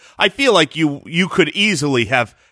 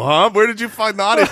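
ordinary talk, nothing marked

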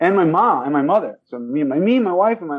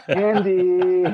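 mock girlish name; 3 semitones higher than usual. Mocking own girlish name.

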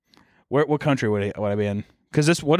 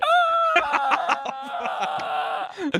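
high-pitched giggle; squealing, embarrassed laugh. Real, not acted. Laughs at his own slip.

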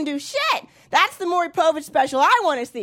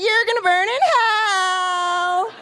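higher, slow gleeful damnation; more excited; 5 semitones higher, much slower than usual. Shown as a demonstration. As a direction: relish the threat, delighted. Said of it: "say that a little more excited".

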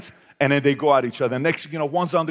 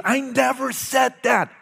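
denial shout; 11 semitones higher, slower than usual. Retold or imitated. Son denying, voiced in story.

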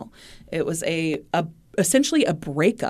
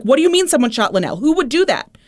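retold panicked disbelief, higher and louder; fast, raised voice imitating a man freaking out; 8 semitones higher, 5 dB louder, faster than usual. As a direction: blurt it like shocked, frantic questioning. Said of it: "he starts freaking out".

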